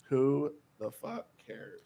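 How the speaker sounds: ordinary talk, nothing marked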